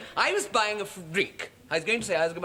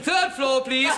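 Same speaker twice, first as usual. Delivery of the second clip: higher, louder hammed-up toff order; mock posh order; 8 semitones higher, 5 dB louder, slower than usual. [name] sending up the posh set with a lift-boy order. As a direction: bark it like a lord to a lift boy.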